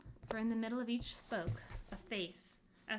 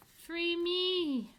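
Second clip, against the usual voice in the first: much higher, loud desperate cry; 9 semitones higher, 10 dB louder than usual. Acted. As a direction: cry out to be freed. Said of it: "cried one voice".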